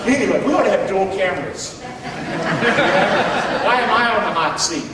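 talking through laughter, slowed; laughing. Real, not acted. Pastor teased by his wife's stare, laughing. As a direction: laugh it off while speaking. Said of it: "She's looking at me right now".

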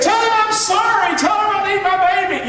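high, loud shouted plea; mock soul singer wail. Preacher hamming the lovesick bride to the congregation, loud and high. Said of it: "That's what she said".

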